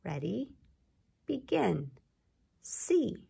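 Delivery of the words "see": "See" whispered, higher. Shown as a demonstration. Teacher whispers see on the second round. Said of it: "Let's do that again".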